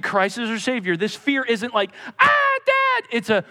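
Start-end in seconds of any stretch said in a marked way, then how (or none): 2.2-3.0 high, loud mock fright at Dad; mock cowering. Pastor mocking a child cowering before dad, high yelp. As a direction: squeal it like a scared kid. Mock fearful cringe at father.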